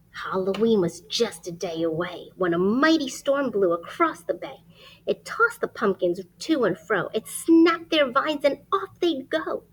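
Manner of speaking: lively rhyming storybook read; reading to children. Acted. Reading a rhyming picture book aloud to children. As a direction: read it like bedtime story time.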